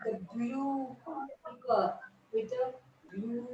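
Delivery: usual speaking voice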